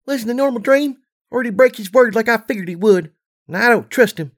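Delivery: put-on high, young filly voice; little filly voice. A narrator's reading. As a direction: voice a wary small child pony.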